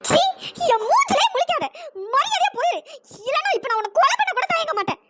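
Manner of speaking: high, furious, spitting each order; furious dismissal. Acted. Maha ordering the man out of her sight, furious. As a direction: drive him out of your sight.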